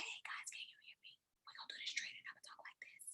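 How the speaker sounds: barely audible voiceless whisper. Shown as a demonstration. Trainer showing a group what too soft is, whispering. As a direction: whisper it so low that nobody can hear. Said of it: "'Cause if I'm whispering".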